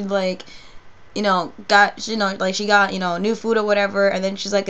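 normal talking voice

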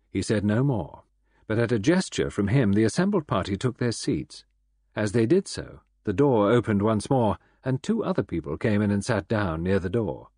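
ordinary delivery, nothing marked

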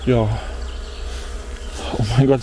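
normal talking voice

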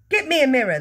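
high, loud commanding yell. A narrator's reading. Father demands a mirror, yelling. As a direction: yell the order. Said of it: "the father yelled".

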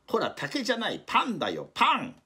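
high, loud scolding. Acted. Someone scolding the panda eating the post, a loud high telling-off. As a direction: tell the panda off sharply.

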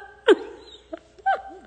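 high burst of laughter; loud laugh. Real, not acted. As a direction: crack up at your own story. Laughs after retelling.

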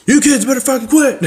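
yelling dad. Acted. Dad yelling at noisy kids, mock yell.

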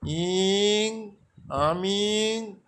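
আমিং stretched out slowly, then দুলোর Mia said firmly; teacher repeating the phrase slowly for memorising; slow Santali drill. Shown as a demonstration. Teacher has learners repeat the Santali sentence, slow. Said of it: "তাহলে হচ্ছে দেখুন".

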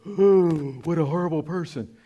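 mock-scandalised gasp, then tutting; hammed-up gasp of outrage; shocked judgment. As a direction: play the pearl-clutching churchgoer. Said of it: "everybody goes".

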